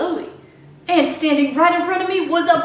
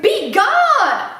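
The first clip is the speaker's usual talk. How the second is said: high, loud "Be gone!" yelled at the bear; screamed command; 10 semitones higher, 6 dB louder than usual. Retold or imitated. Old woman retelling how she screamed at the bear, high and loud. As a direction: scare the bear off with a yell. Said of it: "and screamed".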